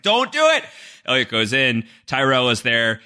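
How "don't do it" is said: high, squeaky mock warning; a hammed-up plea not to go in. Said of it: "Mister Robot's like".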